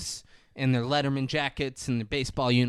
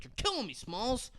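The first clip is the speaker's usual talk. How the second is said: higher but soft, exasperated; lightly voiced famous movie line; exasperated Ham; 8 semitones higher, 10 dB quieter than usual. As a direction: sigh it out in disbelief.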